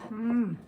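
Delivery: loud pleased hum; loud yum. Real, not acted. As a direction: hum happily at the taste. Tasting food.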